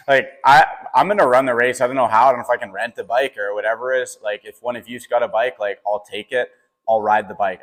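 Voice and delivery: excited, defiant shout of resolve; fired-up insistence. Retold or imitated. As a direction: declare it like nothing will stop you. Defiant retold reply to team.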